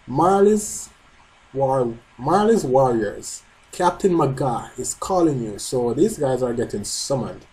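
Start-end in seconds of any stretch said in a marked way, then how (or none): none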